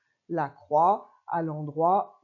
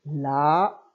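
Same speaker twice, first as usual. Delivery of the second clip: each word read slowly, split in two; lecture de mots; 2 semitones lower, 4 dB louder, much slower than usual. Shown as a demonstration. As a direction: read the words aloud slowly for a child. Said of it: "on essaye de faire ensemble". (mots lus lentement et clairement)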